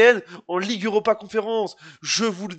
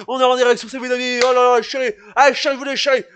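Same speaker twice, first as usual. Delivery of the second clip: ordinary talk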